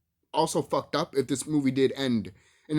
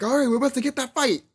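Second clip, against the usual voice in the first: high, eager voice of an excited viewer; acted excitement, pitched way up; hyped-up anticipation; 11 semitones higher, 4 dB louder than usual. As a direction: build up the big fight excitedly.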